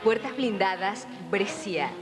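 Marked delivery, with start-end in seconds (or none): none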